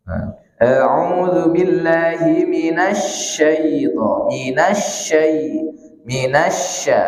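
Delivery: slow chanted a'udzu billahi with minasya as sh; slow Quranic recitation; careful recitation. Shown as a demonstration. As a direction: recite slowly, stressing the sh in minasya. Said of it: "Bukan minassa, tapi minasya".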